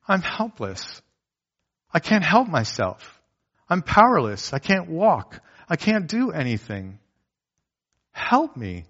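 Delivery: put-on a heart crying out to Jesus like a child; childlike plea.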